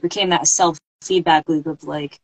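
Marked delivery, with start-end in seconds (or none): none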